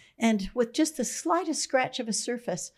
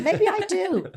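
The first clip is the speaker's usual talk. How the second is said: laughing, pitched higher; 9 semitones higher, 4 dB louder than usual. Real, not acted. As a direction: toss it off with a high laugh. Laughing admission to herself.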